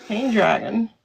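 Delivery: usual speaking voice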